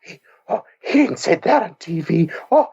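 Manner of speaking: high, gasping mock shock; playing his twelve-year-old self, scandalised; shocked kid. A boy hearing a curse word on TV, mock shocked. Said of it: "I was like".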